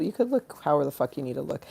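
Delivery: normal talking voice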